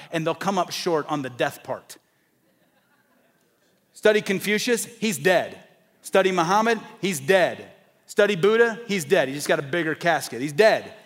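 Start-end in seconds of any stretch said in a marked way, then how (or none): 3.9-10.9 preacher pounding out each name and "he's dead"; preaching at full force. Acted. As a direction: drive the list home like a revival preacher. Preaching climax on dead religions.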